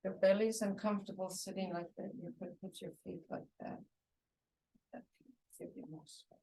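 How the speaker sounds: quick, very soft aside, fading to a whisper; hushed aside. Real, not acted. Teacher privately adjusting one student's sitting posture, hushed aside. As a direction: drop to a fast, discreet murmur.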